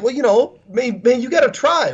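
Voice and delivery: high, light, laughing it off; sheepish self-quote. Retold or imitated. Brushing off the pastor's scolding letter, high sheepish self-quote. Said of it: "And I was just like".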